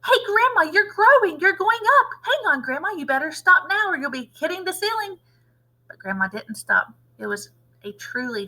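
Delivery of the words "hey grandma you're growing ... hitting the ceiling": put-on high, loud alarmed shout of a young boy. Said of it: "gave a jump and shouted".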